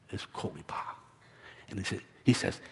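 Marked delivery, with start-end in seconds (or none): 0.1-0.9 low, near-whispered word; quiet storyteller's hush; hushed reveal. A narrator's reading. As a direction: let the secret word out very softly. The secret word spoken softly.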